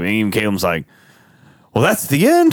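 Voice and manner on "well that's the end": high, rising disbelieving question; mock astonishment, a bit louder; incredulous. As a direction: imitate a baffled viewer, pitch jumping way up. Acts out a viewer's disbelief at ending.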